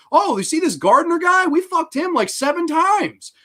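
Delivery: put-on high, loud bragging girl; hammed-up bragging girl. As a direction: boast it up high like a party girl. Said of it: "They're like".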